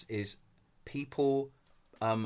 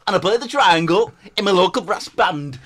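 high, loud silly character; Brett Tuggle; 7 semitones higher, 9 dB louder than usual. Pretend. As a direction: introduce yourself in a daft put-on voice. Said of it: "my name's Brett Tuggle".

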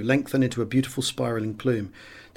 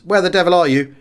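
loud, higher, irritated demand; exasperated radio call; 5 semitones higher, 5 dB louder than usual. A narrator's reading. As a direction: snap it over the radio. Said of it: "I called to one of my section leaders".

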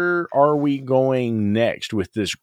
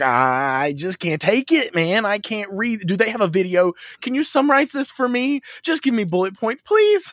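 whiny mock pleading; 12 semitones higher than usual. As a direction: imitate a friend begging to be spared the reading. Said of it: "he's like".

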